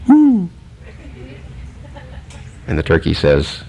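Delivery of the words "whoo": high falsetto hoot; short falling owl call, up high. Acted. Preacher voicing the owl in the tree, high hoot. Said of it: "And the owl goes".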